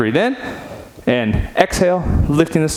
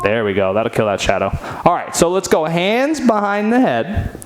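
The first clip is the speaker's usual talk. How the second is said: ordinary talk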